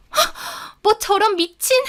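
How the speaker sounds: high, appalled scoff; huff, then scoff in disbelief. Acted. Rival reacting to the threat, scoffing.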